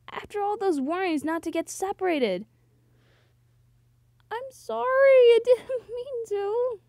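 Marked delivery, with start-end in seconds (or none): 4.3-6.8 high, drawn-out apology with a laugh breaking in; tearful apology. Acted. Heroine apologising to her rescuer, mock tearful. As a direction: plead in a slow, hammed-up high voice.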